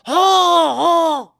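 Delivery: high, loud imitation of the son's yell; father imitating the son's yell heard through the house, mock shout; retold yell. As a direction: mimic the scream that came from the room. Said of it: "اتنی زور سے چیخیں آپ".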